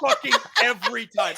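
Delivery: loud laughing words. Real, not acted. Still laughing at the Vine.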